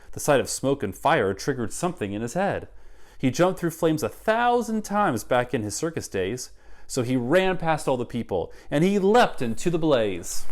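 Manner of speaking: put-on lively rhyming read-aloud for kids; story narration. A narrator's reading. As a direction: read it like a picture book to children. Reads picture book aloud.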